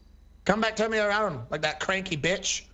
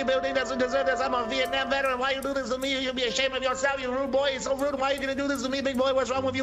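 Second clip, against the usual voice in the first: higher-pitched, lightly voiced complaining old man; aggrieved old veteran; 6 semitones higher than usual. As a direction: play a wronged old vet pleading his case. Plays old veteran Bill Dean.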